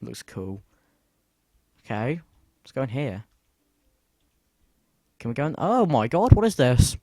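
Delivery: ordinary talk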